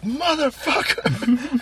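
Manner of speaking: mock furious shout. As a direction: drag it out like a contestant who just lost big. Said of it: "they're just like".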